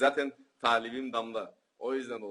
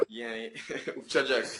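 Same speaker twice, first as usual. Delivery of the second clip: laughing; 12 semitones higher, 5 dB louder than usual. Real, not acted. Laughing at own joke.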